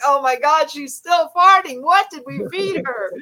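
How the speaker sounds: acting out disbelief at the footage; shocked reaction.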